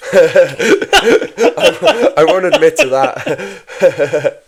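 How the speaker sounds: high, loud, through laughter; real giggly protest; laughing denial. Real, not acted. Laughing at an offensive suggestion.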